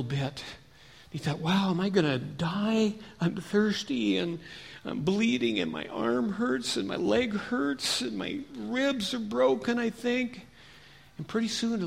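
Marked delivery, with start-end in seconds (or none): 1.4-10.5 slow, higher-pitched pained complaint; suffering man. Acted. Man left on the trail counts his injuries, suffering. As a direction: drag out each hurt, pitched up.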